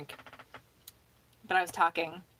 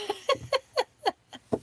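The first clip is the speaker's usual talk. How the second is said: high, rippling laugh; rueful laugh. Real, not acted. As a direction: laugh it off in a long giggle. Joking about world falling apart.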